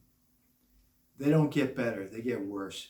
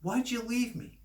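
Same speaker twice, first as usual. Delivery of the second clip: higher-pitched mock complaint; imitated accusing ex-wife; 9 semitones higher than usual. Retelling his ex-wife blaming him, mock accusing. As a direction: play the ex turning it around, high-voiced. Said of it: "she'll say".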